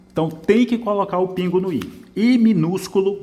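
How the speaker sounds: teacher insisting on the dot over the i; stressed reminder. Shown as a demonstration. Teacher correcting a student's essay, emphatic. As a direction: pitch jumps up high on "I minúsculo". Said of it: "você precisa colocar o pingo".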